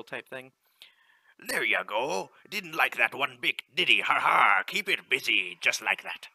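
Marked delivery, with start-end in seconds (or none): 1.4-6.3 louder, hearty sea captain reading the in-game lines; ship captain. Acted. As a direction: call it out like a captain on deck. Captain cheering shots.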